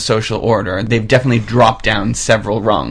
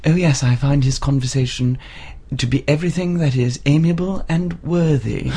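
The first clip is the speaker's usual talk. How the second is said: softer, prim posh English; quietly mannered period English voice; prim restrained quote; 2 semitones higher, 5 dB quieter than usual. Retold or imitated. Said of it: "say things like".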